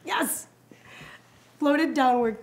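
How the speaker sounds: ordinary delivery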